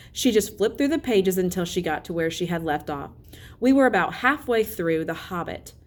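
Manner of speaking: ordinary delivery, nothing marked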